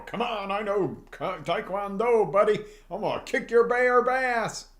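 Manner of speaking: high, loud hammed-up bravado; mock tough guy. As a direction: puff up like a cocky fighter taunting a bear. Mocking tough guy facing bear.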